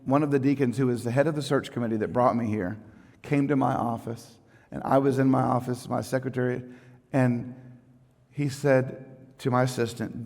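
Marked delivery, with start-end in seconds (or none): none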